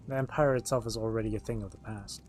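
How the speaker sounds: normal talking voice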